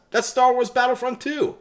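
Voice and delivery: mock know-it-all gamer. Answers his own question as a smug veteran player. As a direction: play the smug veteran boasting. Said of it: "I just asked".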